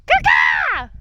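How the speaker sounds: shouted crow caw; loud caw call. Real, not acted. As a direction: caw down the mountain to a friend. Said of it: "Should I caw at him?".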